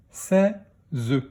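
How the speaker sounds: "Seize" split into sè, ze; "Seize" in two beats; syllable by syllable. Shown as a demonstration. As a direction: sound out "Seize" with a separate final ze. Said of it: "dans quelle syllabe".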